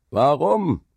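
high, loud challenge from below; rough shout. A narrator's reading. Worker below challenges the dike master, rough shout. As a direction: shout it back up defiantly. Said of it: "schrie eine raue Stimme von unten herauf".

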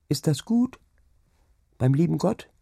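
put-on high, small questioning child voice; child's question. Child asks father about heaven, high and wondering. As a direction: ask innocently, rising.

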